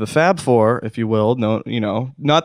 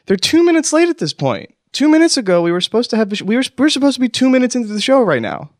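panicky; 11 semitones higher, faster than usual. Acted. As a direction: voice climbing in a panic. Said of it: "you can hear the panic in my voice".

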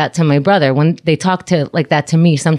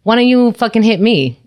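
raised, daring provocation; raised angry dare; defiant challenge; 6 semitones higher, 3 dB louder than usual. Retold or imitated. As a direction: dare him to swing at her. Daring the neighbor to fight.

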